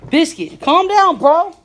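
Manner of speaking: loud scolding. Real, not acted. Owner loudly scolding his jumpy puppy Biscuit, high and loud. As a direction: rein in the jumping puppy with a loud high call. Telling the excited dog off.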